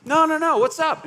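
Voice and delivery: frustrated. Acted. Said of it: "they notice we're frustrated".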